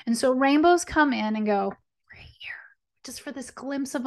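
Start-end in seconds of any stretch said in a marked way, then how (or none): 2.1-2.5 breathy whisper of the rainbow. Acted. Rainbow speaking, whispered aside. 3.0-4.1 whisper. Acted. Rainbow speaking, whispered.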